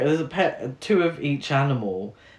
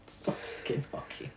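whispered; 15 dB quieter than usual. Shown as a demonstration. Repeated as a whisper.

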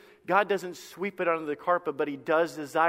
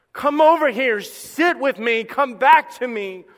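loud, urgent plea to come back, slowing down; pleading call; 4 semitones higher, 8 dB louder, slower than usual. Acted. God calling the sinner to come back, loud and pleading. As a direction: call them home with open arms.

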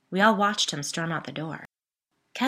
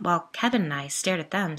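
usual speaking voice

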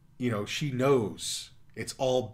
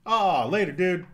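cheerful farewell; 8 semitones higher, 8 dB louder than usual. Real, not acted. Farewell to leaving viewer.

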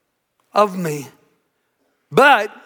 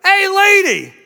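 high parrot call; cheeky parrot heckle, pitched way up; squawking parrot; 7 semitones higher, 3 dB louder than usual. Acted. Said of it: "Parrot goes".

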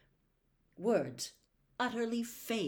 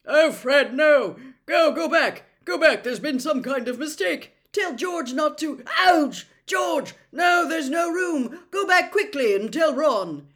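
high, very loud frantic warning; loud, high-pitched alarm with a yelp of pain; urgent shouting; 8 semitones higher, 12 dB louder than usual. A narrator's reading. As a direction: shout back to those behind you to turn round. Shouting from inside a blocked fireplace.

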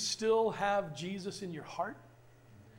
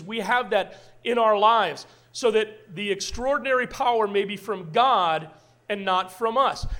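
louder, proclaiming reading of Paul; loud preached scripture; 7 dB louder than usual. Acted. Pastor reading Second Corinthians aloud, preaching at full force. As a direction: proclaim the verse to the church.

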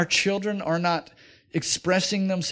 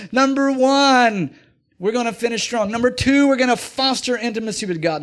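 emphatic recap; 6 semitones higher, 5 dB louder than usual. Acted. Pastor recapping his sermon points to the men, loud and emphatic. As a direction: drive home each point.

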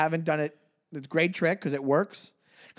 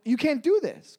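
pitched-up mock protest; imitating his own indignation, higher; retold outrage; 11 semitones higher than usual. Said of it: "I go".

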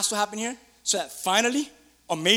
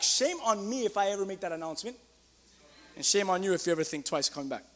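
ordinary talk

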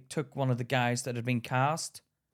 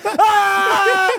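a much higher, much louder hammed-up scream; mock battle scream; 12 dB louder than usual. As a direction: let out a wild scene scream. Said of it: "Everyone screaming".

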